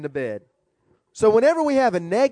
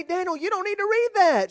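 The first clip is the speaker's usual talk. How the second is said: wife's objection; 9 semitones higher than usual. Retold or imitated. Wife scolding husband in story.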